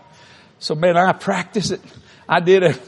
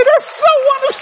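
very high, loud gushing wife's voice; imagined sweet reply; 5 dB louder than usual. Pretend. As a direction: gush it like the happy wife he pictured.

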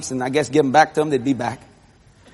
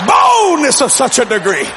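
slow, loud, high-rising proclamation; grand declaration; 4 semitones higher, 4 dB louder than usual. Retold or imitated. Boy boasts of his sudden boldness, declaiming. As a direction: boom it out with a drawn-out first word.

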